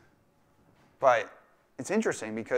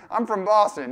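mock Boston accent, pitched up and louder; hammed-up Boston accent with a laugh in it; fake Boston accent; 8 semitones higher, 7 dB louder than usual. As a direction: badly fake being from Boston. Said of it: "tried to fake a Boston accent".

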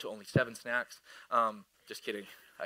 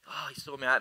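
usual speaking voice

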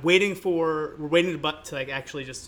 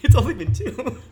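laughing; 8 semitones higher, 8 dB louder than usual. Real, not acted. Laughing while correcting co-host.

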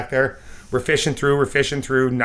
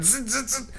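high, soft buzzing of fishing line; a light zzz of line stripping off; reel buzz; 11 semitones higher, 8 dB quieter than usual. Retold or imitated. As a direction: imitate the reel spinning out, quietly. Imitating line coming off reel.

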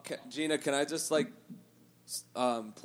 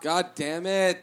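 exasperated groan; 6 semitones higher, 9 dB louder, much slower than usual. Real, not acted. Groans after hearing she's hot.

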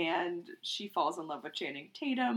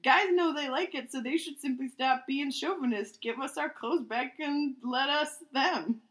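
reading aloud; 6 semitones higher, 3 dB louder than usual. Acted. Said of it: "Fourth sentence".

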